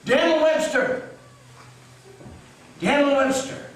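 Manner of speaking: calling out high and loud over a grave; loud and clear call. Acted. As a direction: summon him loudly by name, pitch thrown way up. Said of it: "speak loud and clear".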